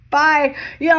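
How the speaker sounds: high, loud, carefree goodbye; mock breezy bye. Imitating someone breezily waving off an ex, high and loud. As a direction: wave them off brightly.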